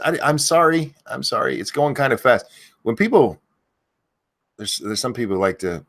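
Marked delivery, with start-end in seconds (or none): none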